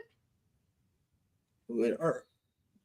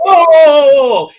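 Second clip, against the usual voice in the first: shout; 5 semitones higher, 9 dB louder than usual. Real, not acted. Preacher exclaims at the text.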